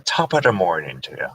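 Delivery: stock stage-Irish greeting; leprechaun Irish accent. Shown as a demonstration. Host attempting Northern Irish, leprechaun Irish accent. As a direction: put on a leprechaun's Irish lilt. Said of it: "That's just my standard leprechaun accent".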